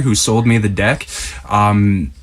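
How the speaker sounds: usual speaking voice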